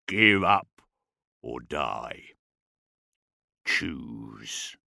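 orc ultimatum sinking very low; low guttural demand. A narrator's reading. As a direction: drop to a deep, menacing orc rumble. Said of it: "His Gothic was guttural".

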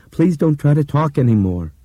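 loud, high, desperate begging; panicked plea. Acted. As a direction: beg her in rising panic. Panicked plea to dying girl.

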